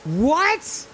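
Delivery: sharp high shocked yelp; stunned high yelp; shocked what. Real, not acted. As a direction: react in sudden disbelief. Shocked reaction to game mechanic.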